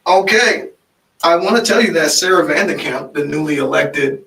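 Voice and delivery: loud, lively announcement; announcing a shout-out. Real, not acted. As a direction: call it out proudly to the listeners.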